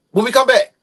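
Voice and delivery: high, loud break teaser; hyped announcement. Real, not acted. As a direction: call out over the crowd. Hyped call to audience before break.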